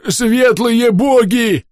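high slow bubbling yell; gurgling wail. Acted. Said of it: "забулькал, завопил старший носильщик". (булькающий вопль)

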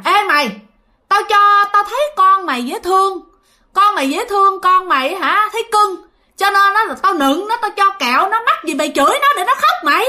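high-pitched ghost woman telling someone off; high, insistent berating voice; imitated scolding. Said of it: "Bà này bà chửi hả".

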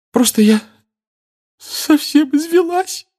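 very high, slow tearful voice; worn-out tears. Acted. Mrs Weasley worn out, crying again. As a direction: let the exhaustion spill into tears. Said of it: "сказала она".

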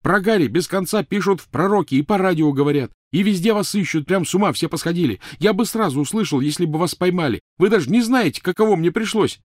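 high, loud, heated explanation; shouted self-defence. Acted. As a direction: argue at full volume. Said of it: "перекричав её".

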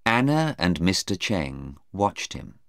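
plain reading voice

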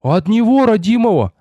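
put-on high-pitched rapturous boy; entranced, joyful exclamation; spellbound repeating. A narrator's reading. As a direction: keep insisting high and excited. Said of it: "как заговорённый твердил послушник".

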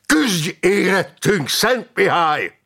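high, drawn-out war cry; yelled battle prayer. A narrator's reading. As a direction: call slowly and forcefully on the saint. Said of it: "üvöltötte, kardját a magasba emelve".

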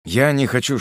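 plain reading voice

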